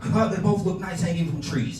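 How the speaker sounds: high, slow punchline in an uncle's voice; laughing uncle. Retold or imitated. Uncle telling nephew his raunchy joke, laughing uncle voice. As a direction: act out the uncle cracking himself up. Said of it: "But he's laughing".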